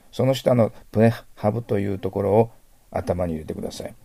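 ordinary delivery

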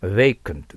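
vacant said slowly as "beikunt", a clear model word; pronunciation model. Shown as a demonstration. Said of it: "発音は".

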